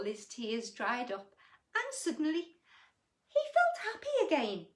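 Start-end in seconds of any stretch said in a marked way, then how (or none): 1.7-4.7 high, loud, beaming reading; joyful. A narrator's reading. Reading the happy turn to children, joyful. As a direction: brighten right up with joy.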